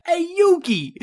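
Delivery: high, loud cartoon Brooklyn shout; Joey Wheeler. Co-host cheering like Joey Wheeler, high Brooklyn shout. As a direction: yell it like the anime sidekick. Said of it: "You're Joey Wheeler".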